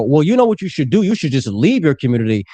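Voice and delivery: normal talking voice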